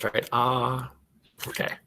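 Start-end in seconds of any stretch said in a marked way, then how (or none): 0.3-0.9 AH drawn out as at the dentist; held, soft "ah" for the dentist; open ah sound. Shown as a demonstration. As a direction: open wide for a long "ah". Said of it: "like the sound when we go to the dentist".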